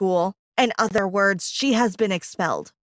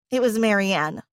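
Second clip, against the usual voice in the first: usual speaking voice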